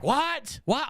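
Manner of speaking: imitating wife's shocked outburst; shocked what. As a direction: voice jumping high in mock shock. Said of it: "And his wife's like".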